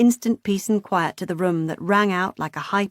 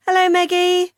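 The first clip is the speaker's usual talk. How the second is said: calling out from afar; 11 semitones higher, 6 dB louder than usual. A narrator's reading. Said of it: "Vicky in the background calling out".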